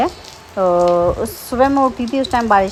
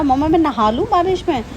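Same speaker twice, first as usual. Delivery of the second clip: put-on higher, childlike pleading question; a hint of a small boy begging to play in rain; imitating son asking; 6 semitones higher than usual. Mother voicing son asking to bathe in the rain, lightly childlike. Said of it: "कुंज कह रहा था".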